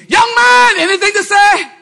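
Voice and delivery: loud, high taunting challenge; demanding an answer from the Pharisee; challenging shout. Acted. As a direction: call them out, loud. Challenges the rich young man.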